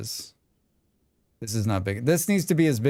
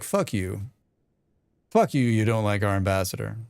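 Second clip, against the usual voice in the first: lower New York version; 4 semitones lower than usual. Retold or imitated. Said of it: "is that more appropriate?".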